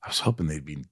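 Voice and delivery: low, hushed aside; quiet retold letdown. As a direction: murmur it low, as a private disappointment. Voicing private letdown about co-stars.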